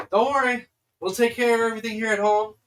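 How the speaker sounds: loud, high send-off from the doorway; calling out goodbye. Acted. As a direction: call cheerily after departing friends. Character calls farewell from doorway.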